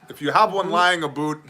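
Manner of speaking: Canadian accent, "about" turned into "a boot"; mock Canadian accent. Shown as a demonstration. Redoes line as mock Canadian.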